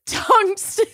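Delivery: high, giggly reading. Real, not acted. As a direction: read through giggles. Still laughing, high.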